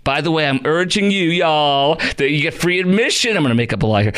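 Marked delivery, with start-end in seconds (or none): none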